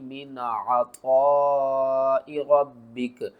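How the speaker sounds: drawn-out, chanted tajweed reading of हा उलाई; slow Quranic recitation. Shown as a demonstration. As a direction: recite slowly, stretching each vowel. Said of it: "इनको किस तरह पढ़ना है".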